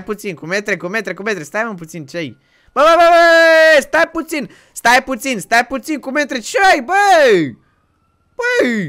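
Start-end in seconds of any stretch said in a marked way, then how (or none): 2.7-8.9 high, loud alarmed yelling; frantic high shouts of stop; panicked shout. Acted. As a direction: yell him back from the edge. Panics as friend threatens jumping.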